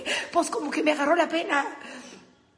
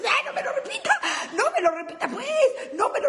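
high, laughing protest; laughing mock protest; exasperated cry; 7 semitones higher, faster than usual.

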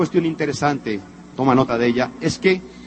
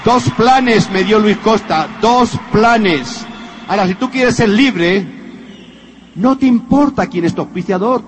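louder, emphatic sales-pitch preaching; motivational preaching; 6 semitones higher, 4 dB louder than usual. Acted. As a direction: drive the message home to the audience. Motivational push to audience.

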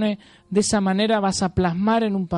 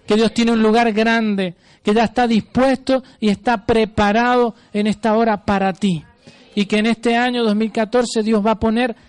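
loud, fervent preacher declaring blessings; preaching at full force; 2 semitones higher, 4 dB louder than usual. Acted. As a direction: proclaim it to the congregation with full power. (predicación a todo pulmón)

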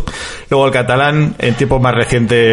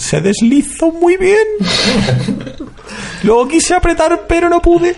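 much higher, squeaky joke voice; mock high voice. Joking about the Durex ad couple, much higher mock voice. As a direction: pipe it up high like a silly ad character.

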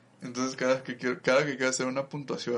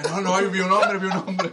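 higher, louder, flustered witness voice; mock panicked caller; 8 semitones higher, 7 dB louder than usual. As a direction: play a jumpy informant phoning it in. Mock call to the prosecutor. (testigo nervioso, más agudo y fuerte)

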